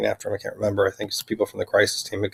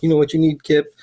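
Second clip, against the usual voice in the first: higher, louder put-on voice; mock taunting voice; 7 semitones higher, 5 dB louder than usual. Voices talking through the speaker to Kip, mock taunt. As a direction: imitate someone needling, pitched up and loud.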